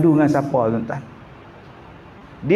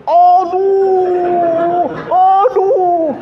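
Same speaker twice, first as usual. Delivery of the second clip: much higher, louder pained aduh twice; mock groans; 12 semitones higher, 4 dB louder, much slower than usual. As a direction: moan twice in pain. Groaning at final sitting.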